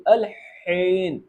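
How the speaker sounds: الحین with a strong breathy ح, drawn out slowly; breathy Arabic ح. Shown as a demonstration. Teacher shows students the ح in الحین, slow and breathy. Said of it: "ہا کی آواز نکالیں گے".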